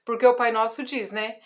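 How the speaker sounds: ordinary talk, nothing marked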